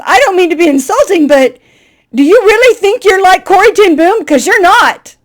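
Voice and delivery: high, loud mock challenge; host teasing the guest loudly; blunt ribbing. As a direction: poke fun at her, laughing.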